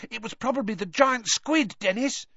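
put-on high-pitched eager boy; excited. A narrator's reading. Boy explaining to his little brother, excited. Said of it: "just as excitedly".

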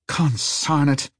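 slow, grumbling under breath; muttered curse. Acted. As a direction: mutter it slowly in annoyance. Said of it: "muttered Harry".

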